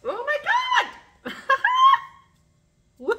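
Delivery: high, loud shriek of joy breaking into laughter; screaming with delight; real delighted shriek. Real, not acted. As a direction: squeal it out, overjoyed. Recognizes the book.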